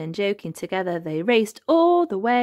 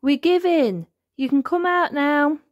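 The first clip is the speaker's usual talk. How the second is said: high, raised voice to carry; calling out; 8 semitones higher, 4 dB louder than usual. A narrator's reading. Elmer calls to his hidden cousin, calling out. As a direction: announce surrender to someone hidden. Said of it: "called Elmer".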